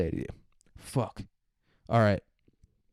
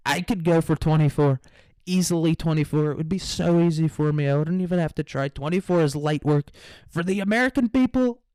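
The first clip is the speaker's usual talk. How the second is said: higher, boastful mock Trump brag; Trump impression; 5 semitones higher than usual. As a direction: puff up and brag like Trump at a rally. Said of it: "that's what Trump's gonna come back with".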